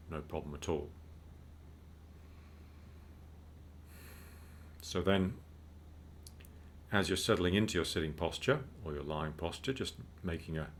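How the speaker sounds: calm, unhurried meditation guide; measured mindfulness instruction with spacious pauses; guided meditation. Real, not acted. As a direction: guide listeners gently into breathing, with long pauses.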